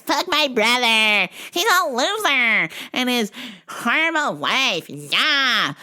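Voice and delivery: mock bratty sister. The sister complaining at the club, bratty. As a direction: whine like a spoiled brat. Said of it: "She's like".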